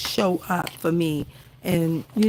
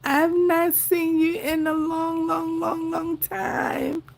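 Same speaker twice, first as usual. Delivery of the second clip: crying with joy; 9 semitones higher than usual. Real, not acted. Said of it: "somebody got wet eyes tonight".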